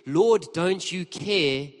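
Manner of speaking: ironic repetition, Lord drawn out; slow, pointed Lord. Shown as a demonstration. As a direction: let the irony sink in, slowly. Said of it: "This is the Lord who".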